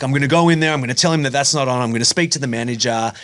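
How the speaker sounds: mock charge in to defend her; indignant protective outburst, imitated; protect mode. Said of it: "instantly go into, like, protect mode".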